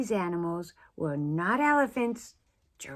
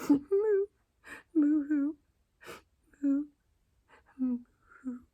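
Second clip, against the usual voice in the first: high, hammed-up boo-hoo moo; mock crying moo; 9 semitones higher, 3 dB louder than usual. Miss Moo crying alone, high mock sobbing moos. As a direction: wail the moos like a cartoon cry. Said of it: "she began to cry".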